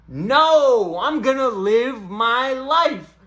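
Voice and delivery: usual speaking voice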